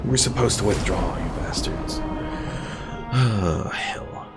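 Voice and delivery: ordinary talk, nothing marked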